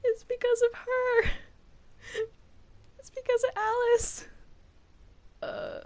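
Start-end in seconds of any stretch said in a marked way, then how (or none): 0.0-4.2 1 shrill blame. Acted. Blaming Alice while attacking.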